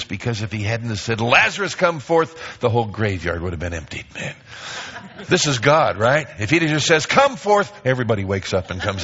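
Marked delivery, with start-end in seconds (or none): 1.2-2.6 commanding call. Retold or imitated. Jesus calling into tomb. 7.1-7.7 high, loud summons; booming "Come forth"; commanding call. Retold or imitated. Jesus calling, mock.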